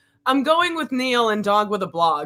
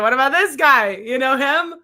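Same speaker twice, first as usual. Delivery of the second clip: higher, pushy mock questioning; mock interrogator; 4 semitones higher, 5 dB louder than usual. Guest mimicking the host's picture quiz, mock interrogation. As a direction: fire questions like a comic interrogator. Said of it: "This feels like an interrogation".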